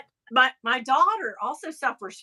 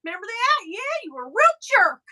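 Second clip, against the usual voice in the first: much higher, taunting sing-song; mock gloating; 9 semitones higher than usual. Host taunting a rude star on his way back, high mocking voice. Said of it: "And I'm like".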